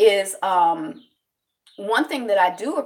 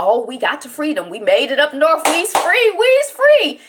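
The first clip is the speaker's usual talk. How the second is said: rising to a high, loud joyful shout; retold celebration building to a jubilant yell; joyful freedom cry; 7 semitones higher, 6 dB louder than usual. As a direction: cry out that you're finally free. Enslaved people celebrating freedom.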